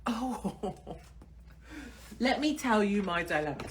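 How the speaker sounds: normal talking voice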